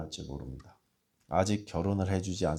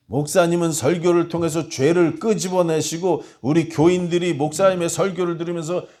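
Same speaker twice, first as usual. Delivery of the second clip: louder, higher, fervent sermon voice; preaching at full force; 9 semitones higher, 9 dB louder than usual. Acted. Pastor preaching to his congregation, full force. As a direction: preach it out to the hall.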